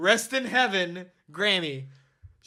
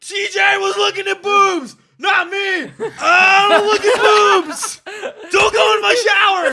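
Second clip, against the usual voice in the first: high, loud, half-crying panic; panicked shout; 14 semitones higher, 12 dB louder than usual. Retold or imitated. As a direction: shout your innocence in a frantic wail. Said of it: "and I'm like".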